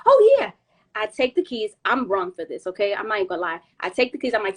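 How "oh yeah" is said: loud, high mock realisation; sudden realisation. Storyteller replaying remembering she has the keys, sudden realisation. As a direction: re-enact just remembering, punched out loud.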